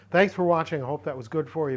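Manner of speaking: ordinary talk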